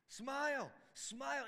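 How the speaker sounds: loud, high exhortation; rising preacher's shout of smile; emphatic smile call. Acted. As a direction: call it out, urging them to smile. Pastor urging smiles.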